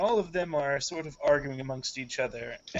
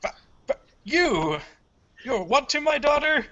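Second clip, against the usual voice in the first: high, loud, spluttering outburst; splutter, then climb to a loud, drawn-out order; outraged father; 12 semitones higher, 8 dB louder than usual. Acted. Father learning of daughter's lover and calling guards, outraged shout.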